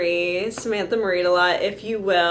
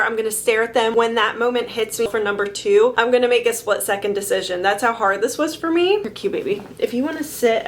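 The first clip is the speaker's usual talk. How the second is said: ordinary talk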